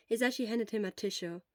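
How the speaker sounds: plain reading voice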